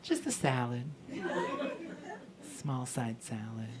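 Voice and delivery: low, soft, pointed murmur; Salad Cindy. Acted. Boss letting staff know she eats healthy, hushed. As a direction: drop it quietly so everyone notices. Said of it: "really let us know that she eats healthy".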